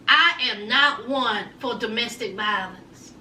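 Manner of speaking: ordinary delivery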